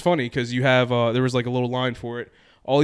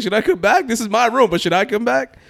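high, loud, rising unsure questions; baffled politeness in your own room; awkward polite question; 10 semitones higher, 7 dB louder than usual. Retold or imitated. Asking the painter, high and awkward.

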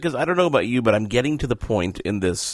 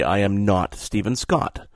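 normal talking voice

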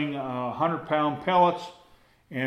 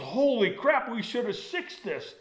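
startled exclamation; 8 semitones higher than usual. Retold or imitated. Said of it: "He goes".

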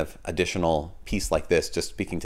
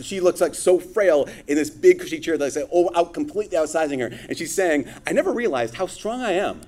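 homily voice, higher and livelier than his chat; preaching a homily; 8 semitones higher than usual. Acted. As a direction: preach it to the congregation, lifting the voice.

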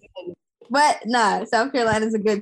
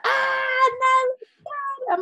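mock groan; 13 semitones higher, much slower than usual.

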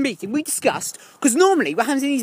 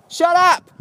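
mock shout; 6 semitones higher, 4 dB louder than usual. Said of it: "mock yelling at him".

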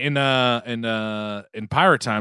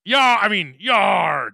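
pirate yar; 4 semitones higher, much slower than usual. Acted. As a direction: bellow it like a buccaneer. Pirate greeting reply.

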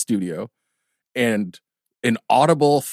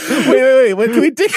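громко и высоко со смехом; перебить сквозь смех; laughing protest; 13 semitones higher, 7 dB louder than usual. Real, not acted.

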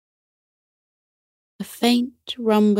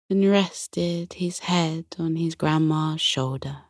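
usual speaking voice

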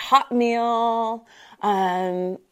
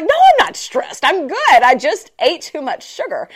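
very high, louder reassurance; cheerful denial; 5 semitones higher, 5 dB louder than usual. Retold or imitated. She reassures the concerned friend, high cheerful denial. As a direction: laugh off the worry brightly. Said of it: "I'm like".

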